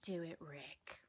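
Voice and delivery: breathy egging-on whisper; whispered aside urging Rick on. Retold or imitated. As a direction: hiss it like a sidekick urging him on.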